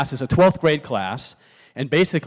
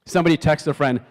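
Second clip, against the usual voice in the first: normal talking voice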